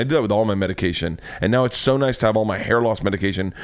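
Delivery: ordinary talk, nothing marked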